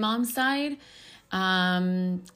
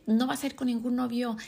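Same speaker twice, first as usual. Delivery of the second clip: strict mom voice; 4 semitones higher, much faster than usual. Retold or imitated. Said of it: "my mom was the type que".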